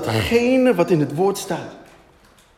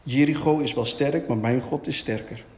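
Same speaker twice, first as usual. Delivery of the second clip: low-pitched murmur of faith; murmured declaration; 6 semitones lower than usual. Shown as a demonstration. Preacher demonstrates Joshua mumbling the word, low murmur. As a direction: recite it low, as if to yourself. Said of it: "begint te mompelen".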